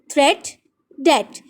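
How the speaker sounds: थ्रेट and debt with a short "e"; rhyming pronunciation. Shown as a demonstration. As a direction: rhyme थ्रेट with debt, silent b, a pause between. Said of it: "इन सब की sounds different हैं". (थ्रेट, debt छोटी "ए" में, रुककर)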